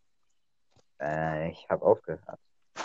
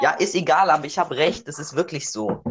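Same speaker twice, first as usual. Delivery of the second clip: excited; 6 semitones higher, 3 dB louder than usual. Real, not acted. Insisting he is right.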